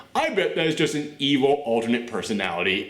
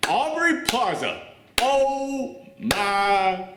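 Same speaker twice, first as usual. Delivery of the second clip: high, drawn-out gushing; real fan excitement, pitched up and slow; excited screaming; 7 semitones higher, much slower than usual. Real, not acted. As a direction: stretch each word out in high-pitched awe. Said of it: "I have been screaming right now".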